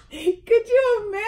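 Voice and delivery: high, loud, laughing exclamation; incredulous and cracking up; amused disbelief. Real, not acted. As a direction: squeal it through real laughter.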